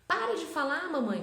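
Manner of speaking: put-on high, loud childish protest; hammed-up kid shouting at mom; imitating a child's outburst. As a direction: mimic a little girl telling her mom to stop. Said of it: "ela fica falando".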